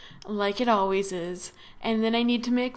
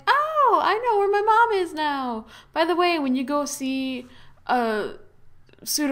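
put-on high, excited child ghost; squeaky kid talking fast; little kid voice; 6 semitones higher, 3 dB louder than usual. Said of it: "he's all like".

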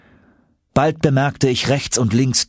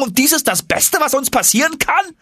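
very high, aghast echo of the uncle's words; incredulous young Axel. Acted. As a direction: throw his own words back in disbelief.

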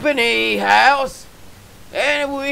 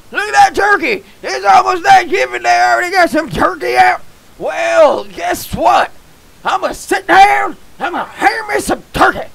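higher, fast excited rambling; 7 semitones higher than usual. Real, not acted. Gamer thrilled at the Thanksgiving turkey, excited and higher. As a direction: rattle it off high and hyped.

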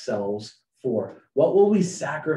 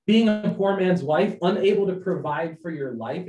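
loud mock Hamilton plea; Hamilton's question; 7 semitones higher, 8 dB louder than usual. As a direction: press her hard with the question. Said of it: "Hamilton sings over her".

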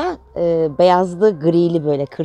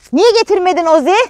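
high, loud call to Ozi; 11 semitones higher, 5 dB louder than usual. Real, not acted. Calling Ozi from across the yard, loud and high.

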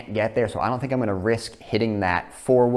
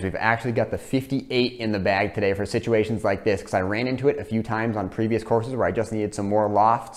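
ordinary delivery

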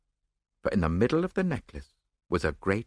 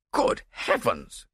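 slow, drawn-out distracted exclamation; a flustered nobleman's outcry, not shouted; distracted cry; much slower than usual. A narrator's reading. Nobleman startled by an expected visitor, distracted cry. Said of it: "cried the distracted nobleman".

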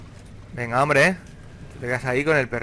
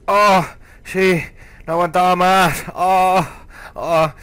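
loud, raised groans of relief; hammed-up relief; 3 semitones higher, 7 dB louder, much slower than usual. Player finally reaching the toilet, hammed-up relief. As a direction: moan out mock relief, full voice.

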